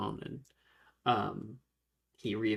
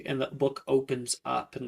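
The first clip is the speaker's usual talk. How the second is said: ordinary delivery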